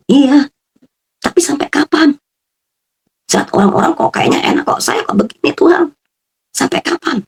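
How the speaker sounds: high, strained cry of despair; anguished plea to God. Retold or imitated. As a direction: plead upward, worn out and hurt. Retold complaint crying out to God.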